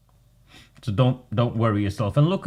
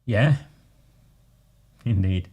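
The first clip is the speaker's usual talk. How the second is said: normal talking voice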